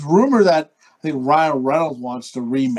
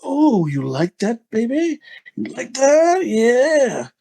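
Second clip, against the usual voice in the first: slow, teasing come-on, rising higher; mock seduction; 4 semitones higher, much slower than usual. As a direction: croon it as a hammed-up sexy bonus. Joke seductive bonus for listeners.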